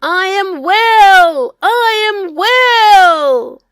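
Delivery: loud, slow, high cry of joy; joyful shout. A narrator's reading. Healed captain shouting to his waiting wife. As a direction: belt it out, overjoyed. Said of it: "he shouted".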